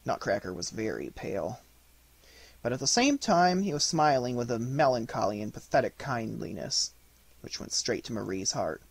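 ordinary delivery

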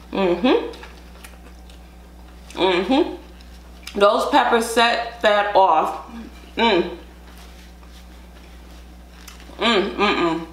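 muffled behind napkin; wiping mouth while chewing; talking through napkin. Real, not acted. Seen 0: Napkin pressed to mouth through most words, removed by 8.3.

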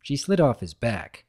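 ordinary delivery

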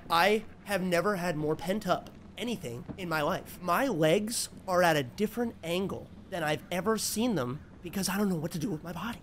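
ordinary talk, nothing marked

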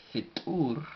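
Fitur with a popped T into a long u; explosive T. Shown as a demonstration. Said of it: "una T explosiva con la U".

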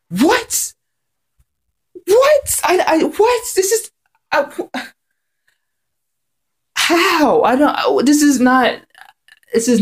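very high, stammering shocked cries; real disbelief. Real, not acted. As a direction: sputter in utter disbelief, voice cracking up high. Reacting to Frost's erasure.

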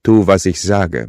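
slow, loud order; commanding. A narrator's reading. Killer ordering his captive priest, slow and loud. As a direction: lay down the command, word by word. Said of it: "befahl ich".

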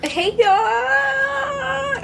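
high, stretched hey y'all call; a long, squealing hey y'all greeting. Real, not acted. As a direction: call out big to the camera. Calling out to the camera.

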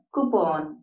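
"കൂപ്പോൺ" as koo-PON, full round O; correct pronunciation. Shown as a demonstration. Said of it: "അതിന്റെ ശരിയായ pronunciation". ("കൂപ്പോൺ" ശരിയായി, "പോൺ" നീട്ടി)

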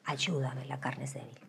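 desperate whispered plea; hushed prayer for help. Retold or imitated. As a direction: pray under your breath.

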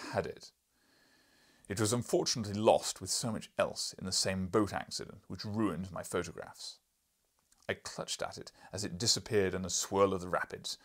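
loud, booming lecturing professor; gruff professor. Acted. Professor lecturing the journalist, loud and booming. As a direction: boom like an irritable scholar.

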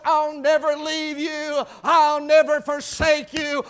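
loud, high preacher's shout; shouted promise. Acted. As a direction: cry the promise out to the church.

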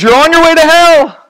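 high, loud bullhorn preacher shout; hammed-up hellfire street preacher; street preacher yell. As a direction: bellow it like the guy on the box with a bullhorn. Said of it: "the guy with the bullhorn".

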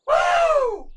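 very high sustained "woo"; triumphant whoop. Real, not acted. Celebrates the diamond.

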